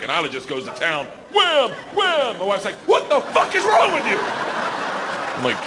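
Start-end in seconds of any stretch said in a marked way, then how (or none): 1.3-2.4 two high, sharp bursts; punchy exclamations. Acted. Child goes to town on the trick, high punchy exclamations. 2.9-4.2 high, incredulous mock outburst; outraged wife. Wife scolding husband over the trick, mock outrage. Wife's shocked reaction, acted.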